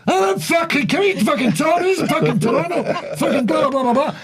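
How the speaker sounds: high-pitched shouted tirade, tumbling into blahs; mock furious rant. Comic mimics offended Toronto taxi driver, high ranting shout. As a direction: imitate an outraged driver going off.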